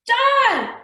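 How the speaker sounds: loud high scream of defiance. A narrator's reading. Boy screaming at the attacking fire monster. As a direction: scream it at the fiery man. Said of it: "I screamed".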